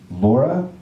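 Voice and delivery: normal talking voice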